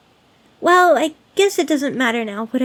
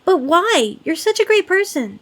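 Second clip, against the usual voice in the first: louder, higher protest; indignant disbelief; 5 semitones higher, 6 dB louder than usual. Acted. Demon hearing the birthday visitor is alone, indignant. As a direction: burst out in disbelief on their behalf.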